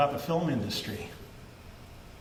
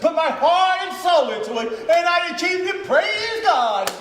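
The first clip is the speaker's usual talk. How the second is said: loud, higher, crowing boast; triumphant shout; 9 semitones higher, 5 dB louder than usual. Acted. Convict gloating about fooling the parole board, shouting. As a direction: shout it out like you won.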